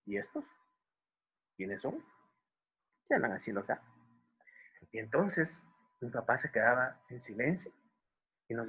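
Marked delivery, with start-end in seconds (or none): 0.0-3.8 quiet, sceptical questions with long pauses; dignified hawk. Pretend. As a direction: size them up softly, one question at a time. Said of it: "levantan una ceja como diciendo".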